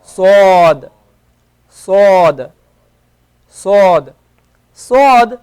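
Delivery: emphatic Arabic sad, said loudly. Shown as a demonstration. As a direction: push out a heavy, hollow s. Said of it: "sword doesn't have a s sound".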